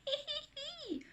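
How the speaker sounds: acted child's hee-hee; high soft giggle. A narrator's reading. Anna heard laughing by father, soft giggle. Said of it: "he hears Anna laughing".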